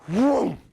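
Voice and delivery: loud swooping whoosh noise; whooshing vroom. Acted. Queen hurls the snake through the sky, loud whoosh. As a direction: make the sound of something flung away.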